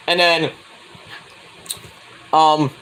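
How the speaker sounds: ordinary talk, nothing marked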